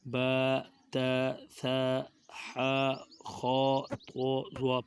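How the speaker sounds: "با، تا، ثا" with open long a endings; Arabic letter pronunciation. Shown as a demonstration. Teacher models Arabic reading, open-vowelled "با، تا، ثا". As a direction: recite "با، تا، ثا" separately as "baa, taa, thaa". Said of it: "عربی لہجہ میں ادا".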